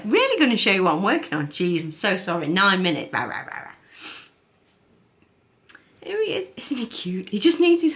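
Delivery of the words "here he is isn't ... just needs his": high-pitched gushing; overwhelmed by cuteness. Real, not acted. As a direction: squeal it out, delighted. Showing off cute crochet toy.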